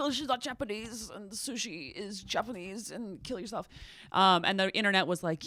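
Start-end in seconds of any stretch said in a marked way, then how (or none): 0.1-3.7 lightly put-on voice, softer delivery; mock online critic. Retelling the man's sushi complaint, mock critic voice. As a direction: imitate a complainer, soft and a little mocking.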